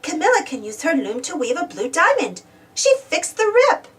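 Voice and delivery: plain reading voice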